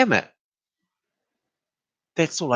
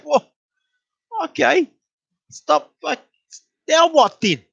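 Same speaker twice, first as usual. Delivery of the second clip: high-pitched exasperated reply; exasperated concession; 14 semitones higher than usual. Retold or imitated. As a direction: give in impatiently, voice way up. Acted reply after being told off.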